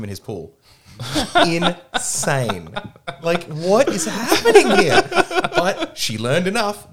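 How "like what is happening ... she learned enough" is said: high, slow words broken by laughter; giggling in disbelief while telling it; laughing. Real, not acted. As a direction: laugh your way through the disbelief. Laughing through telling absurd story.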